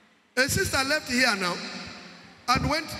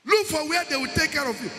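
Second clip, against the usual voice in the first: impassioned preaching; 4 semitones higher than usual. Acted. Preaching at full force.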